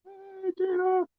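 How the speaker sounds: very high, soft and slow, Tina drawn out; sing-song her name way up high and slow; playful high call. Pretend. Calls to Tina again, changed voice.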